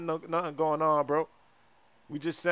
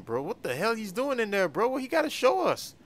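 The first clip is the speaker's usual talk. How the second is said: ordinary delivery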